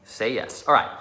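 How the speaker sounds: normal talking voice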